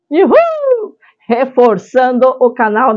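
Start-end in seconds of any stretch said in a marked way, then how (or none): 0.1-1.0 squealed high woo-hoo; high cheer. Real, not acted.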